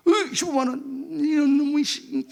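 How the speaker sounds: hammed-up grumble at a fine; mock irritation. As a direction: act out the old annoyed self. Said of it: "신경질 나잖아요".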